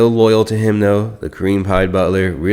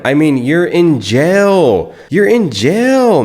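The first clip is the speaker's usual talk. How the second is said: loud, slow, disbelieving; can't believe he's in jail; incredulous; 9 semitones higher, 5 dB louder, slower than usual. Real, not acted. Mocking Wes from jail.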